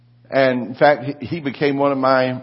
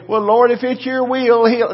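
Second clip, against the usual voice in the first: higher, hesitant mock prayer; mocking weak prayer; 11 semitones higher, 5 dB louder than usual. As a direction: parody a timid prayer. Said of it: "I don't want somebody".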